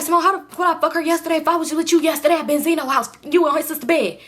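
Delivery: exasperated. Retold or imitated. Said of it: "He's like".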